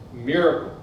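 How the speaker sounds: usual speaking voice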